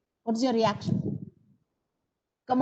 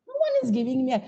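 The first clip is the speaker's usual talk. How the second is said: ordinary talk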